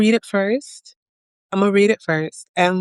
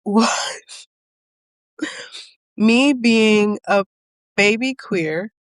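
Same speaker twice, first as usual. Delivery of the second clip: ordinary talk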